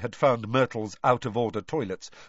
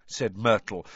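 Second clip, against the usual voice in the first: plain reading voice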